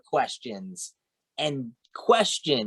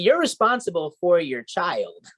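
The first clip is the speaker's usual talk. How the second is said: usual speaking voice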